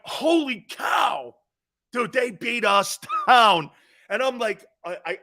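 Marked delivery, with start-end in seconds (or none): 0.0-3.7 high, slow mock shout with a laugh; exhausted outcry. Worn-out freshman yells about punishing practices, high and slow. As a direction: cry out how beaten down you are.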